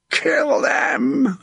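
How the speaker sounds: very high, strained, drawn-out scream; battle scream. A narrator's reading. Sir John urging his men into the French, screaming. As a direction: scream the order to attack. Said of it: "he screamed".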